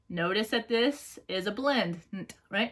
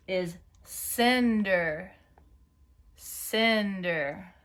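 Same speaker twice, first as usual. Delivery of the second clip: very slow dictation of the word; much slower than usual. Shown as a demonstration. Teacher dictating to young spellers. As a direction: stretch the word out for writers. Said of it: "let's check it together".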